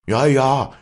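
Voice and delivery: high, loud joyful shout; jubilant cheer. Acted. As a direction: cheer with relief. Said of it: "schallte es jubelnd". (jubelnder Ruf)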